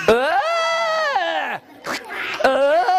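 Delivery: straining high yells while pulling; effortful cries. Acted. Storyteller acts out the strain of pulling from the mud, high yells.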